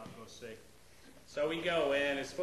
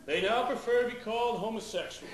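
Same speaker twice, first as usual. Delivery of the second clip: higher, louder mock announcement; dad's pompous update; 7 semitones higher, 8 dB louder than usual. Dad back from secret meetings, mock announcement, higher and louder. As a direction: announce it like official news. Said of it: "coming back with updates".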